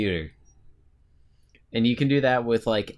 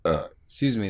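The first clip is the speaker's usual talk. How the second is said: burping the words. Real, not acted. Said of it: "That was the burp I was waiting for".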